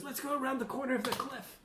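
high, quick character voice; Jasmine. Acted. Game master as Jasmine inviting the player round the cliff, high and quick. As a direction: pitch it well up and hurry it along. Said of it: "So she goes".

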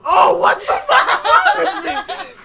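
very high, loud laughing. Real, not acted. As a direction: laugh at yourself. Laughing at own flub.

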